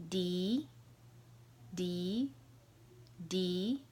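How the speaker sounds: "D" as "dee", held then rising; second tone. Shown as a demonstration. Teacher drilling while learner tries, rising "dee". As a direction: let each "D" climb upward like a question. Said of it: "So the four tones are".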